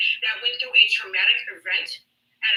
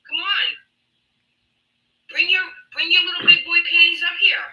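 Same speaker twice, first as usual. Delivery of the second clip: goading; 5 semitones higher, 3 dB louder than usual. Real, not acted. Goading him to come up.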